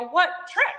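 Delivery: high-pitched disbelieving question; incredulous challenge. Retold or imitated. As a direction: throw it back at her in mock disbelief. Retold incredulous challenge to patient.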